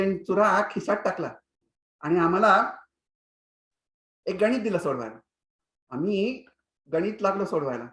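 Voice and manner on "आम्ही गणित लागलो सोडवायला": plain reading voice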